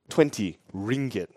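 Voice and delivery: low, quiet and drawn-out offer; cool, low-voiced lowball; deadpan haggling. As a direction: state the price slowly and quietly, no room for argument. Aunt names a haggling price.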